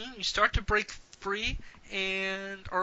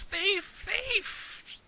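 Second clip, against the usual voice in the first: high, quieter far-off cry of thief; a faint old woman calling from afar; old woman heard from a distance; distant old woman; 7 dB quieter than usual. Acted. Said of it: "Not yelling at it anymore".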